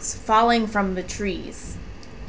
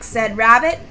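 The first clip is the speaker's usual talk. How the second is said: ordinary delivery, nothing marked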